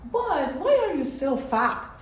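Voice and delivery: usual speaking voice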